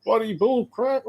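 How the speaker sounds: high mock outburst of a disbelieving dad; scoffing dad. Dad dismisses son's snake story, high mock scoff. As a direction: throw it back as rubbish, high and sharp. Dad refusing to believe son.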